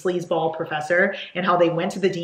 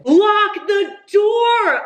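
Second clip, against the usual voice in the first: high, drawn-out yell; exasperated shout; 14 semitones higher, 7 dB louder, much slower than usual. Real, not acted. Reader yelling at book characters who forgot the lock, exasperated shout. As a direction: holler it slowly in exasperation.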